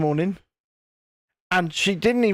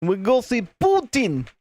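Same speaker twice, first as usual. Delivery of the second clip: hammed-up mock Russian; terrible Russian accent; 2 semitones higher than usual. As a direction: put on a cod Russian accent. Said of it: "terrible Russian accent".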